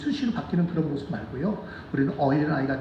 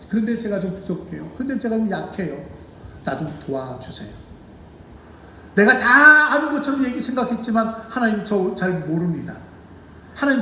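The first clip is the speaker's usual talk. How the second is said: humble prayer; 4 semitones higher than usual. Acted. Pastor voicing a believer's prayer, humbly pleading. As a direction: confess weakness to God and ask for help.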